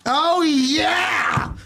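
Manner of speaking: long, high-pitched exclamation; hyped-up cry. Pretend. Boaster hyping himself before his claim, high drawn-out cry.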